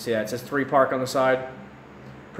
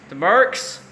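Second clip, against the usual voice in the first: mock outrage; 5 semitones higher, 4 dB louder than usual.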